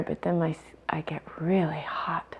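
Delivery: unvoiced, breathy whisper; barely voiced, half to herself. Real, not acted. Student reports heat in meditation.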